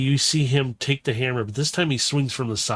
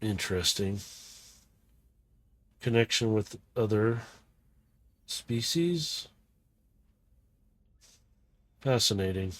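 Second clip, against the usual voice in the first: Shockwave; 2 semitones lower, 7 dB quieter than usual. Acted. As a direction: murmur it like an intrigued cold scientist. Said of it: "And you hear".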